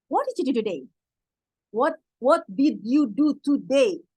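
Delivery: slow, word by word, every word clear; slow careful English. Shown as a demonstration. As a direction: say each word separately like a learner. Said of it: "Kalau bahasa kita nih ya". (pelan dan jelas kata per kata)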